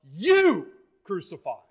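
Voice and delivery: punched "you", falling on "crucified"; emphatic YOU. Retold or imitated. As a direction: hit "you" hard as an accusation. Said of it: "there in the Greek is emphatic".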